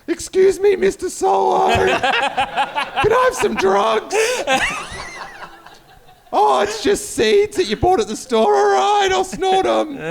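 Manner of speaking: mock starstruck fanboy. Friend mocks him meeting Han Solo, shrill hammed-up fanboy. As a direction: ham up a squealing superfan begging a star.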